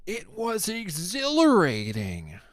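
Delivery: high, loud breathless swoon; mock professor. Mocking the professor who cheered the attack, high gushing swoon. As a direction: gush it like a thrilled academic. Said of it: "that professor that was like".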